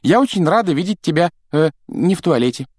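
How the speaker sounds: overly chirpy politeness; put-on cheer, fast and high; fake cheerful. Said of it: "делано весёлым тоном".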